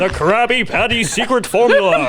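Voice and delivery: high, dramatic cartoon narrator; SpongeBob narrator. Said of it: "SpongeBob announcer".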